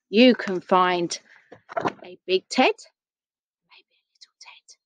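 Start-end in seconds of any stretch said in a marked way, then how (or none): 3.7-4.8 very soft, voiceless whisper; hushed whisper. Real, not acted. Storyteller hinting to listeners at a little teddy, whispered. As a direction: shrink it to a tiny whisper.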